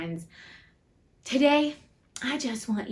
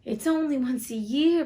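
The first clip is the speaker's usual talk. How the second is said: higher-pitched meek plea; pleading; 5 semitones higher, 4 dB louder than usual. A narrator's reading. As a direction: plead with the boss, pitch raised. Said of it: "pleaded Bob".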